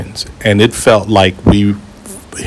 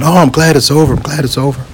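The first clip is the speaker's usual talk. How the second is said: higher voice imitating relief, softening on the repeat; relieved passengers; 5 semitones higher, faster than usual. People talking in the lull after turbulence, imitated relief. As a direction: play a passenger thankful it is over.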